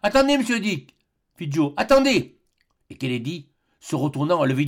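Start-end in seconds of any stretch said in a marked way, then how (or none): 0.0-1.0 high, louder call to stop; urgent cry. Acted. Joe cries out in crisis. 1.8-2.4 high, louder call to stop; urgent cry. Acted. Joe cries out in crisis.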